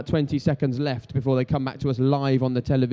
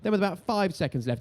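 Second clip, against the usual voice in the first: usual speaking voice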